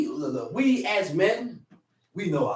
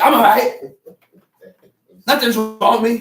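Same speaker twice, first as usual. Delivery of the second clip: louder curt snap; snapping; 9 dB louder, faster than usual. Acted. Wife snapping at husband, curt and louder. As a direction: throw it back sharply.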